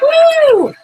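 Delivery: a loud, high whoop of delight; excited whoop. Real, not acted. Host cheering for her viewers after the clip, loud high whoop.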